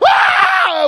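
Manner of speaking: high, loud cry of overwhelm; preacher reenacting his breakdown in chapel with a loud cry; anguished cry. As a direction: let out a sudden loud wail. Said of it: "I'm sitting there going".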